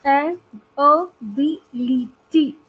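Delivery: ए, अ, बी, ली, टी as separate beats; syllable by syllable. Shown as a demonstration. Teacher splitting a word for students, syllable by syllable. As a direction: sound out ability part by part, with gaps. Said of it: "four syllables होते हैं".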